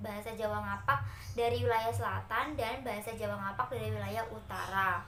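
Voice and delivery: usual speaking voice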